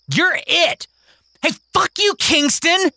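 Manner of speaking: put-on high, angry kid cursing; high child's fury. Kingston cursing at Mary, high and angry. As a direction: lash out at her. Kids fight and curse.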